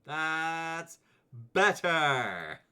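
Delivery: a high, loud pleased exclaim. Real, not acted. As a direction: announce the fix with relief. Camera resized.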